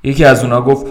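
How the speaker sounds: plain reading voice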